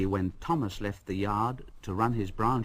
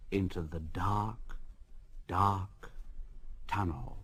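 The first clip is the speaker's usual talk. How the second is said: hushed suspense; 4 semitones lower, 8 dB quieter than usual. A narrator's reading. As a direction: draw out the dark tunnel. Suspense narration.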